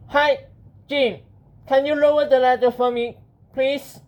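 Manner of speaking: high-pitched, polite hero; Benjamin. Acted. Hero asking the clown for the ladder, high character voice.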